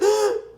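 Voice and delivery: high sharp indignant gasp; gasp, scandalized; offended gasp. Real, not acted. Sister shocked by her brother's remark, offended gasp.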